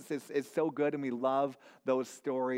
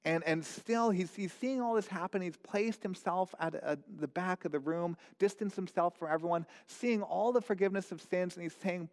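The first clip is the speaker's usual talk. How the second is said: ordinary talk, nothing marked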